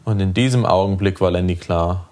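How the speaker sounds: ordinary delivery, nothing marked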